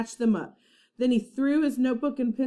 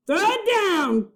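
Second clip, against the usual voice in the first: high loud order; cried out; 11 semitones higher, 5 dB louder than usual. A narrator's reading. Captain Bones ordering Pinky to throw the medallion, loud order. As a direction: bellow up the order. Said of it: "cried Captain Bones".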